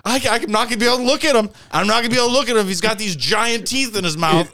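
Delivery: hammed-up frantic rant; Todd freaking out. Friend dreading seeing the teeth, frantic high mock rant. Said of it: "He's like".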